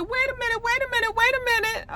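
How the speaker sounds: high, squeaky repeated interruption; startled disbelief. Retold or imitated. Viewer reacting to surprise bailout, startled. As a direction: halt everything in a very high voice.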